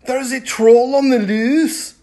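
high, loud alarm; yelled. A narrator's reading. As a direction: shout the news to the chief. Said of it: "they yelled".

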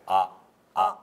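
「あ」を舌と顎を下げて短く; 舌を下に連れていく; 舌を下げた「あ」. Shown as a demonstration. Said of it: "下連れてっていただいていいですか". (下げた短い「あ」)

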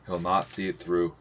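ordinary delivery

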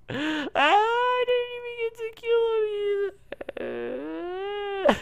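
real gamer sob-whine; high whining cry. Real, not acted. Whining about loss.

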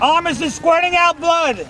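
high, loud, slow shout; shouting over battle. Acted. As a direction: call out the wound loudly. Instructor narrating scenario loudly.

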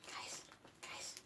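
whispered call. Real, not acted. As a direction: call them softly so they stay. Whispering to the dogs.

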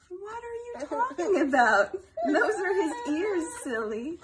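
loud, high mock protest; playful indignation. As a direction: protest with exaggerated disbelief. Playful argument over the picture.

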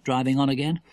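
usual speaking voice

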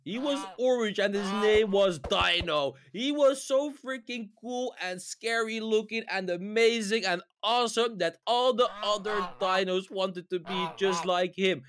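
bedtime story. Acted. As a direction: lull the listener.

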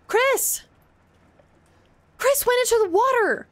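high, startled cry after someone; alarmed calling out. Real, not acted. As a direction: call out in sudden disbelief. Calls after vanished game character.

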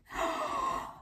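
high, sharp real gasp of surprise; thrilled gasp. Real, not acted. Crafter sees the basket come out, amazed gasp. Mold comes out successfully.

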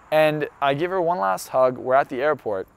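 usual speaking voice

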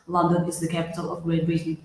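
flat robot reading, fast; robotic, monotonous. Shown as a demonstration. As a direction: drone it like a robot. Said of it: "we talk like robots".

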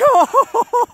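high burst of joyful laughter; delighted laugh. Real, not acted. Laughing after goal.

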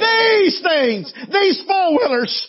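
full-force preaching. Acted. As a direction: preach it out, building to the peak.

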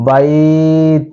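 বাইত with the ai stretched one alif long; slow stretched বাইত; drawn-out bait. Shown as a demonstration. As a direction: hold the vowel in বাইত for a full alif count. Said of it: "এক আলিফ টানতে হবে".

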